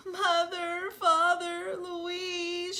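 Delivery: tearful calling. Acted. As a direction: call for family through tears. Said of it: "she cried".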